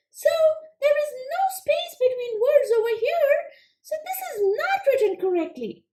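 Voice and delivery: high, quick little frog puppet, eager to answer; squeaky-high puppet frog pleased with itself; Froggy puppet voice. Acted. Frog puppet answering the teacher, high and eager.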